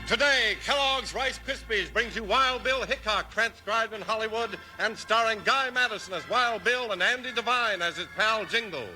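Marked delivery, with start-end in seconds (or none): none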